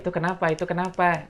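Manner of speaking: bystanders asking. Acted. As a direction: ask it like a crowd at an accident. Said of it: "orang-orang biasanya pada nanya itu".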